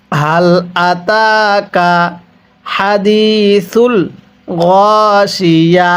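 "আতাকা" drawn out very slowly for repeating; slow recitation model. Shown as a demonstration. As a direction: model each word slowly for the class to echo. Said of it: "কিভাবে শিখব?". (ধীরে টেনে শিখিয়ে পড়া)

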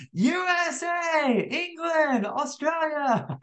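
very high, slow, cartoon-kid voice; eager child calling out to the screen; Dora the Explorer shout. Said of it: "screaming at your screen like Dora the Explorer".

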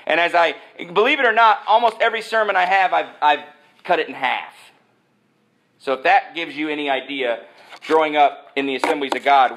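ordinary talk

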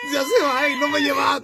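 high, mock-tearful child's wail. Kid reproaching mamá over the missed circus, mock wail. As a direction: whine like a disappointed kid left behind.

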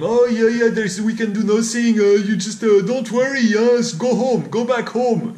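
high, loud brush-off voice; police brushing off the teacher, high and loud; dismissive police. Retold or imitated. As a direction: mimic a cop waving him off. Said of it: "They probably told him".

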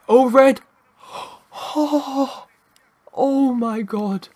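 high, loud shout, then a gasped oh; real gasping excitement. Real, not acted. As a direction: react to a stunning near miss. Nearly scores an overhead kick.

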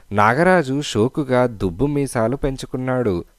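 ordinary delivery

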